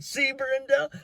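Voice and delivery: very high sing-out to his wife; gleeful told-you-so. Retold or imitated. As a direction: crow it in a squeaky-high pitch. Acted triumphant crowing to his wife.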